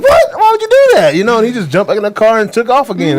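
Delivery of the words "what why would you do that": very high, loud incredulous squeal; hammed-up shocked protest through laughter; mock disbelief. Acts out own disbelief at driver.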